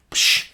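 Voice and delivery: imitated smack, a loud hissed burst; sharp mouth-made slap effect; slap sound. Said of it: "'Cause he slapped me".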